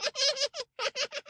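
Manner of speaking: very high, fading mocking hoot; a shrill parting cackle from afar; monkey's high evil laugh fading away; evil hoot of laughter. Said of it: "a parting hoot of evil laughter".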